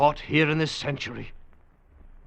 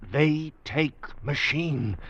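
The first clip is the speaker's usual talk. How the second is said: slow simple speech; 3 semitones higher, much slower than usual. Acted. Simple words to Weena.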